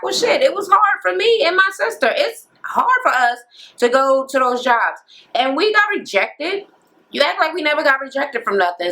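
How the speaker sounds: high, indignant mock whine; mocking the sister's complaint. Andrea snapping back at her boyfriend, high mock indignant. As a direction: pitch way up, voicing the fed-up sister. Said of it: "And she was like".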